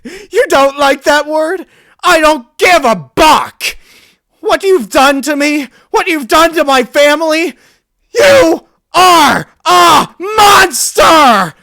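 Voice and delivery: the mare screaming at her family's killer. Acted. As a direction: scream with mounting hatred. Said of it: "she screamed at me again".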